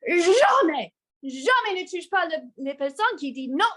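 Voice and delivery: high, loud French outburst; retold full rage. Woman confronting the man in the steam room, retold raging outburst. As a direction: burst out high, scolding in French. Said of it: "full rage".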